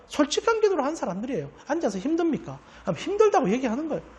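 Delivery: usual speaking voice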